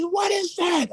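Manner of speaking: very high shrieked question; panicked. Retold or imitated. Gang leader shrieks at the book, panicked. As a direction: shriek it in horror.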